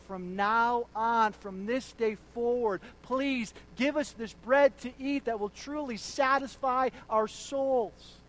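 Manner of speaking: loud, slow pleading request; crowd's plea. Retold or imitated. Hungry crowd begging Jesus loudly for bread. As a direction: beg for the true bread. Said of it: "they said".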